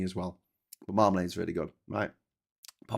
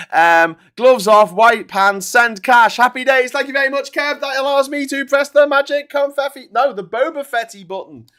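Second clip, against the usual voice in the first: high, loud hype-man shout; hyped up; 12 semitones higher, 14 dB louder than usual. Real, not acted. Thanking Kev for the donation, hyped and loud. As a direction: pump up the crowd.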